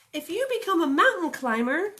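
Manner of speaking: high, loud little bunny. Acted. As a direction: play the runaway bunny, bright and eager. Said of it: "said the little bunny".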